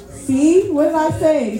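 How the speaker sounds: high, delighted told-you-so; triumphant. Real, not acted. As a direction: pounce on the proof. Ladybug proves her point.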